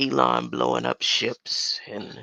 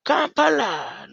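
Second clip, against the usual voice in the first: calling out; 13 semitones higher, 8 dB louder than usual. Real, not acted. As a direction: belt it out like a mic check. Calls out loudly, mic check.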